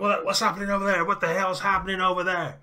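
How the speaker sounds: high, loud shouts across the street; alarmed neighbour. Acted. Neighbours coming out to see a gun, alarmed shouting. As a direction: call out in mounting alarm.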